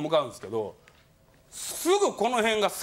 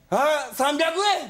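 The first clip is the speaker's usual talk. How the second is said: put-on slow, higher whiny old man; mock old man; 8 semitones higher, 4 dB louder, much slower than usual. Old man insisting to the teller, slow and higher. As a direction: drag out the demand like a stubborn old man.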